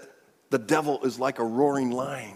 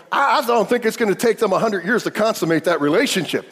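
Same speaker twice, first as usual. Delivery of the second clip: louder, driving sermon delivery; fervent preaching; 6 semitones higher, 8 dB louder than usual. Acted. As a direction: preach it out loud to the congregation. Preaching at full force.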